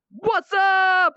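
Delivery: high, loud mock streamer yell; drawn-out parody shout; hyped streamer greeting. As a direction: holler it like an over-the-top streamer. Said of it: "they sort of go".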